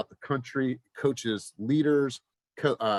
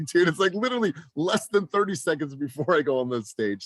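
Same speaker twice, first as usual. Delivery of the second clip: higher, exasperated protest at a last-minute question; exasperated retort; 6 semitones higher than usual. Retold or imitated. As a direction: act out the annoyed reply, half-laughing. Retold exasperated reply to colleague.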